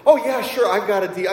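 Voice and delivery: high-pitched mock admission; sarcastic agreement. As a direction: agree as if it were absurd. Mock answer nobody would give.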